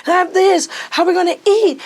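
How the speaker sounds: high, loud frantic question; panicked worry. Retold or imitated. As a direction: sound desperate about feeding the kids. Panicked asking father.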